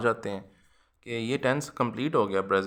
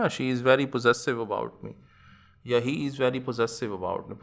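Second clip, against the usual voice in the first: slow example reading; much slower than usual. Shown as a demonstration. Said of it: "example sentences read slowly".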